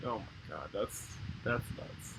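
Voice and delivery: a hushed, slow oh my god, that's nuts; stunned hush. Real, not acted. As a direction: let it fall away in disbelief. Appalled at the live rat.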